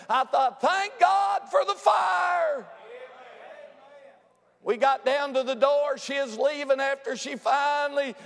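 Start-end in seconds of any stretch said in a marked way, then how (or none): 0.6-2.7 high, drawn-out preacher's shout; exultant shout. Retold or imitated. Preacher thrilled at Sister's praising, exultant shout. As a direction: cry it out in praise, sustaining each word.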